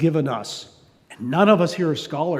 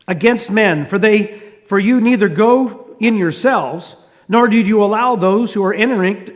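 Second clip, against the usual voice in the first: scripture reading; 4 semitones higher, 5 dB louder than usual. Acted. Reads scripture aloud.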